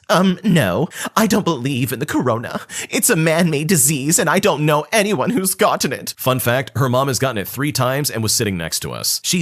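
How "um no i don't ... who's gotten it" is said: high put-on ranting cousin voice; mock anti-vax cousin. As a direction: rant like a know-it-all. Cousin rants against vaccines.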